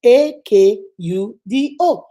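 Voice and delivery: spelled slowly letter by letter; spelling out. Shown as a demonstration. Woman spelling her name for people who can't say it, slow spelling. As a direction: spell it out, each letter given its own beat.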